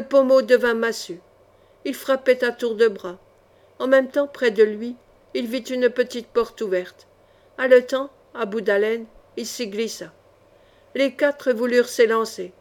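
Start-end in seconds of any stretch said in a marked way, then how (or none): none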